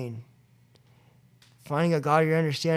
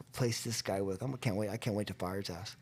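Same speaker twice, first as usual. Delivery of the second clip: much softer and lower, conspiratorial; scheming aside; 6 semitones lower, 12 dB quieter than usual. Retold or imitated. Plotting to replace his sponsor, softly to himself. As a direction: savor it under your breath.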